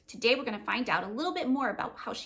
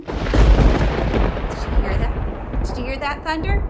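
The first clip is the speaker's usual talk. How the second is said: loud, slow, rumbling thunder call; thunder demo; 4 semitones higher, 11 dB louder, slower than usual. Shown as a demonstration. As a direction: boom it out like thunder. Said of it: "Say we just had a big flash".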